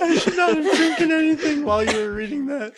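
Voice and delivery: laugh-tinged words; after laughing hard. Real, not acted. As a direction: still giggling while speaking.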